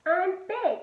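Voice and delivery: put-on high, eager little girl; pleading to join in; little sister voice. A narrator's reading. Sister insists she's big.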